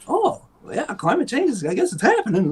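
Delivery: high, airy mocking voice; mock researcher.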